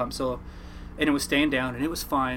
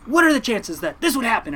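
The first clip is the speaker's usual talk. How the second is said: disbelieving mom; 9 semitones higher, 4 dB louder than usual. Retold or imitated. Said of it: "She goes".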